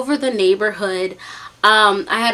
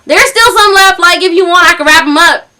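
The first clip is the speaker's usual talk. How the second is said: raised, louder mimicked country caller; thick country accent; 6 semitones higher, 6 dB louder than usual. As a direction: put on a country twang. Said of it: "talking in this thick country accent".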